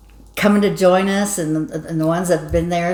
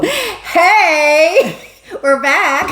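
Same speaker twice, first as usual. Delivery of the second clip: excited punchline; 11 semitones higher, 5 dB louder, much slower than usual. Acted. Punchline of a scarecrow joke.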